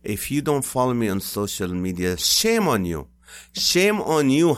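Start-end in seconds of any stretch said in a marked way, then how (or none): 2.2-3.1 loud, higher mock scold; playful shame on you. Scolding audience jokingly. 3.5-4.6 much higher, louder, slower shame; over-the-top scolding; mock outrage. Repeated bigger scold.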